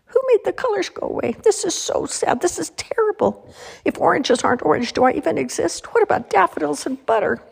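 high-pitched, fretful crayon voices; distressed crayons. A narrator's reading. Crayons upset that the colors are gone, high dramatic wail. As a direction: lament in a high, worked-up little voice.

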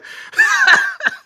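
short high real laugh. Real, not acted. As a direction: let a quick amused laugh out. Laughs at guessing the lyrics.

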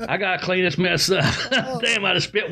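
high giggling through the words; laughing. Real, not acted. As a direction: laugh while talking. Laughing while cleaning up spill.

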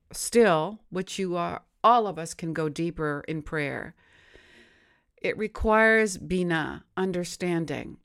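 normal talking voice

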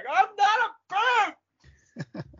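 very high drawn-out protest yell; Kramer. Retold or imitated. Actor quoting the freeze-frame ending, high yell. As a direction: shout it high and slow, hammed-up. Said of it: "freeze frame on him just going".

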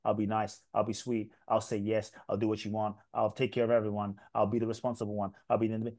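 small, compliant promises; people-pleasing child. Retold or imitated.

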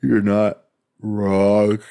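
slow, stretched words through a yawn. Real, not acted. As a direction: talk mid-yawn, drawn out. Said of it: "Can you hear me yawning?".

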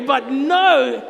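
ordinary talk

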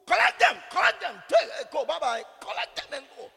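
high, very loud retold order; shouted dismissal. Preacher voicing someone dismissing a bishop's messenger, shouted impatiently. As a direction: act out shouting someone away. Acts out curt dismissal of visitor.

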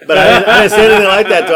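fast, high, loud laughing; laughing aside. Real, not acted. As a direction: laugh while admitting it. Laughing at own restraint.

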